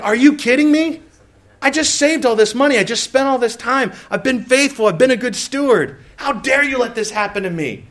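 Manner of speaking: high-pitched, hammed-up outrage at God; indignant complaining. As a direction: complain as if badly wronged. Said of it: "I just go to complaining to God".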